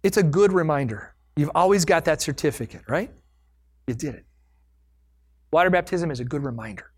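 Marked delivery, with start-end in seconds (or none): 3.9-4.2 soft whisper. Real, not acted. Quiet repeat of praise.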